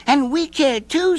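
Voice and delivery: put-on high little girl. A narrator's reading. Nancy reassuring the old man, high girl voice. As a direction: voice her high and sweet.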